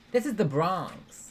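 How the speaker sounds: slow, loud, high New York accent; big mock New York accent. Shown as a demonstration. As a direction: boom it out like a Bronx local. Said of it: "What's the New York accent?".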